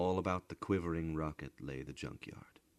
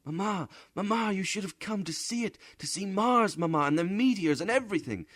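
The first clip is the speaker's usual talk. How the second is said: high, loud, fast gushing; excited children; 9 dB louder, faster than usual. A narrator's reading. Children gush to their mother, high and fast. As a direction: tumble the words out in excitement.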